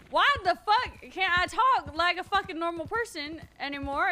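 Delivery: loud, high exasperation; frustrated outburst. Real, not acted. Streamer who can't talk normally anymore, loud and exasperated. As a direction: erupt in real frustration at yourself.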